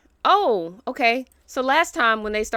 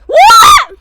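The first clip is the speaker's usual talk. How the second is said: shocked shout; 23 dB louder than usual. Real, not acted. Shocked at what the Sims do.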